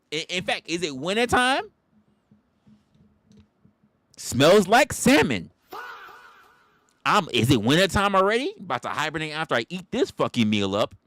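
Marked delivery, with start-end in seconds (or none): 0.7-10.5 loud hungry bear. Pretend. Comic plays the bear, loudly savoring its meal. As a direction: bellow it like a bear sniffing dinner. Voicing the bear smelling salmon.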